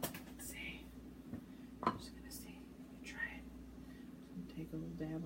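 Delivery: soft breathy whisper to herself. Real, not acted. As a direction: whisper it under your breath. Quiet tasting aside.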